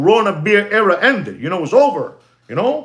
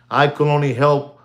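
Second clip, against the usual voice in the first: ordinary delivery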